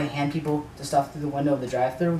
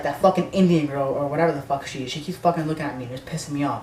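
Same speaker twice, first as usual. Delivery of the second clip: ordinary talk, nothing marked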